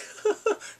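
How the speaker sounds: high, bursting mock laugh; imitated laugh. Father reacting to her answer, imitated laugh. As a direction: imitate his sudden laughing fit. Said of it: "then he starts laughing".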